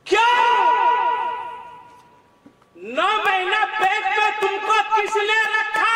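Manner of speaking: high, loud demand; rebuking shout. Real, not acted. Preacher rebuking sons who neglect mothers, loud shout. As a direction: hurl the question at them.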